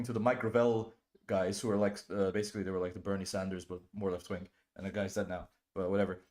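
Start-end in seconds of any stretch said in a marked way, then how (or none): none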